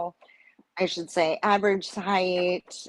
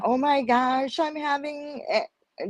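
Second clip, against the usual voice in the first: high-pitched, lightly imitated tearful panic; retold crying; 8 semitones higher than usual. Mother retelling her reaction at the ultrasound, mock crying. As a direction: retell your crying outburst in a raised pitch. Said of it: "just crying".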